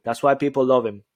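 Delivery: usual speaking voice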